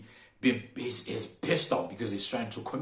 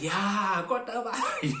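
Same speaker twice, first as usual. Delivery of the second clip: high, laughing re-enacted relief; relieved confirmation; 12 semitones higher, 4 dB louder, slower than usual. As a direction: confirm it eagerly, pitched way up and laughing. Relieved confirmation of the stop's name.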